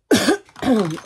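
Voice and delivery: harsh real cough fit. Real, not acted. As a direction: cough hard, then carry on. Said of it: "pardon, je tousse ce matin". (quinte de toux)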